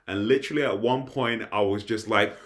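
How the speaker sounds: usual speaking voice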